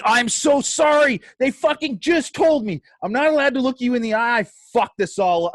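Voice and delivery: babbling to undo the blunder; frantic apology. Retold or imitated. Bodyguard apologising to Kim Cattrall, frantic.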